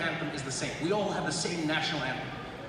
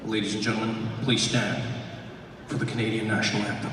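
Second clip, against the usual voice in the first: low announcer voice; lower, rolling stadium announcer; arena announcer; 10 semitones lower than usual. Retold or imitated. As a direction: announce it in a deep arena boom. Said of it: "the announcer in the arena".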